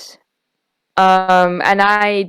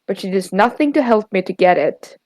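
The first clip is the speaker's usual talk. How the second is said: ordinary talk, nothing marked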